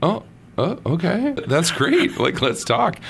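light up at the chance; eager reply. Retold or imitated. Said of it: "I'm like".

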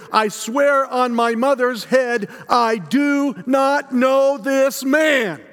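cursing denial. Acted. Peter curses his accuser at the gate, vehement. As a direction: swear it at the accuser, hammering each word. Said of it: "curse my accuser and I say".

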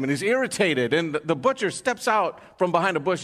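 normal talking voice

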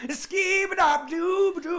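loud, high, slow scat yell out the window; shouted scat. Pretend. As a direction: belt the scat nonsense out the window. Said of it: "open the window and shout".

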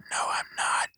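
hushed, whispered retraction; whispered aside. Real, not acted. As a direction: whisper a quick confession under the joke. Whispered confession after a false claim.

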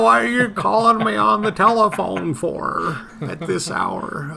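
put-on high, creaky old grandpa voice; grumpy old man woken by phone. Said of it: "sound like an old man".

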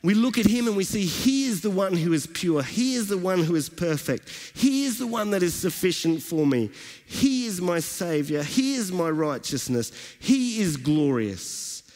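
rising, fervent sermon delivery; preaching. Acted. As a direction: build the praise like a pastor lifting the room. Preaches the passage.

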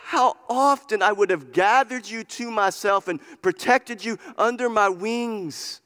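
impassioned preacher's lament, voicing Jesus; crying out. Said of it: "he's crying out to them".

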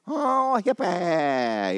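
high, drawn-out mock wail; mock hippie exclamation. Preacher joking to the congregation about a hippie, mock exclamation. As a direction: stretch it out high for a laugh.

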